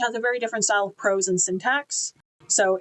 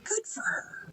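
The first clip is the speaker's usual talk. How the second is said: much softer, whispered echo; whispered repeat; 13 semitones higher, 11 dB quieter, slower than usual. Real, not acted. Reviewer quietly cheering the forty-one-year-old knight, whispered. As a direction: say it again under your breath, in awe.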